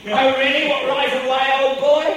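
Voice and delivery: loud, hammed-up posh English accent. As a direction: play a plummy English gent, old boy. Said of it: "hear my English accent coming through".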